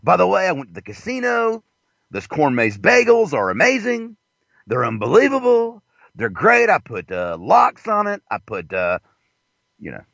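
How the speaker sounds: high, swooping character voice; sing-song caricature, phrases lifting high at the end; Ralph Lifshitz. Acted. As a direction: rave like a hammed-up designer character. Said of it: "My name is Ralph Lifshitz".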